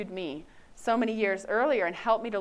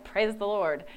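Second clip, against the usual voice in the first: lightly mimicked churchy phrase; a hint of saintly piety; mimicking the pious academy girls; pious classmates. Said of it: "say things like".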